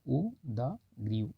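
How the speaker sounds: उदग्रीव very slowly, syllable by syllable, soft; slow spelled out. Shown as a demonstration. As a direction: spell out उदग्रीव carefully as उ-द-ग्री-व. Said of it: "ये मतलब बता दे रहा हूँ".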